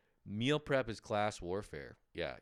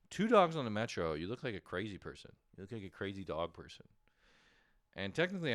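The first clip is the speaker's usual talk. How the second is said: ordinary talk, nothing marked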